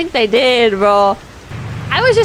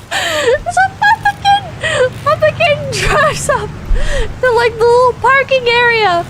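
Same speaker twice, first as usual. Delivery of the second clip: laughing while talking; 11 semitones higher than usual. Real, not acted. Laughing through the drive-thru story.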